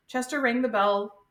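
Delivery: ordinary delivery, nothing marked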